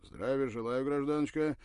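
loud, brisk military-style hello; hearty man's greeting. A narrator's reading. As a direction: bark a cheerful soldierly greeting. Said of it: "неожиданно бодро поздоровался он".

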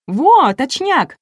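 высоко и громко, с радостью; протяжный возглас радости; обрадованно. A narrator's reading. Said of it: "обрадовалась Буба". (радостно, высоким громким голосом)

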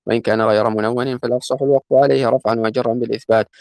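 normal talking voice

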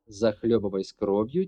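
usual speaking voice

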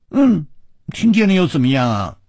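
high, brisk defiant comeback; defiant retort. A narrator's reading. Girl brushing off a warning, defiant. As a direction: toss it back, unafraid and challenging.

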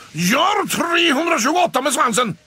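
high, loud jeering demand; loud high heckle; mocking shout. A narrator's reading. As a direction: humiliate him before the crowd. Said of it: "ropade Måns".

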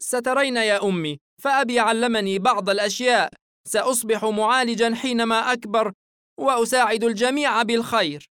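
put-on high, determined child's voice; resentful young boy. A narrator's reading. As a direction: play a little boy vowing to prove himself. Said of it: "زفر الصغير بامتعاض".

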